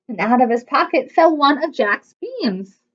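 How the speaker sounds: ordinary delivery, nothing marked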